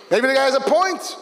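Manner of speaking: the evil inclination leaning in. Retold or imitated. Evil inclination pressing harder.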